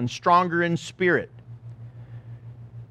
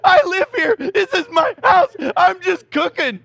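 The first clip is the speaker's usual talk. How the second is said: startled neighbor; 11 semitones higher, 6 dB louder than usual. Retold or imitated.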